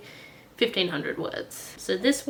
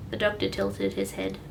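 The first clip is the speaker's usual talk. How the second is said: ordinary delivery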